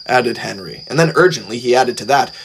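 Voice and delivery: ordinary delivery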